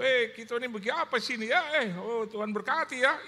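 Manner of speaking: put-on loud, high Papuan-accented greeting; imitated Papuan accent. Preacher greets people in Papua, loud high Papuan accent. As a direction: call out heartily in a Papuan accent. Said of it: "Saya pernah ke Papua".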